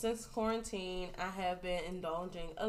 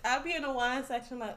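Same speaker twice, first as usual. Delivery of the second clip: ordinary delivery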